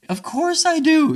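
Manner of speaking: high, loud sarcastic yelp; mock indignation. Mocking the defensive partner, sarcastic and loud. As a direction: overplay the hurt defensiveness.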